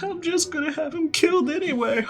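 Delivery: high sneering voice ending in a laugh; gloating brat with cackle. Retold or imitated. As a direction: gloat over a betrayal. Helmeppo gloating to escorts.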